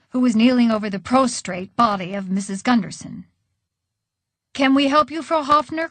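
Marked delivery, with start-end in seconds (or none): 4.5-5.9 higher-pitched call to a neighbour. A narrator's reading. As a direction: call over with an offer of help. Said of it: "called Grandpa Willie".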